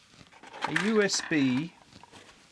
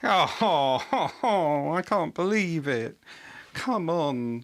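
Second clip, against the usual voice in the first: loud groaning "oh"s, easing off into exasperation; exasperated wail, each phrase falling away; 4 dB louder, slower than usual. Real, not acted. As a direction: groan in real dismay at your own bad luck. Cannot find the lost cable.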